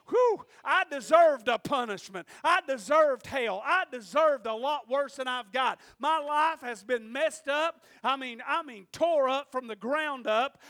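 loud testimony from the pulpit, building in force; impassioned preaching. Real, not acted. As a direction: punch out each phrase to the congregation.

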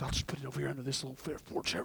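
fast, low, very soft muttering; furtive mumble. Acted. Man hides his found treasure, furtive muttering. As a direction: mumble it secretively, acting.

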